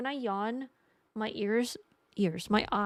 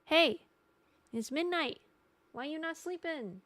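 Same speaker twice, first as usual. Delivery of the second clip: nagging body; 6 semitones higher than usual. Pretend. Body to streamer at midnight, mock nagging. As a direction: play the body as a gentle nag. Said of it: "your body's like".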